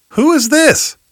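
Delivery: "Who is this?" with a high, wondering rise; rhetorical wonder. Acted. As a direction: lift "Who" high, astonished. Repeats the question, rising.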